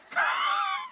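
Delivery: sudden high laugh; high burst of laughter. Real, not acted. Pastor laughing at his own punchline, high laugh.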